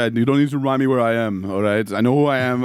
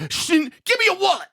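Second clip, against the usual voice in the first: much higher hammed-up demand; mugger threat. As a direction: squeal the demand like a cartoon thug.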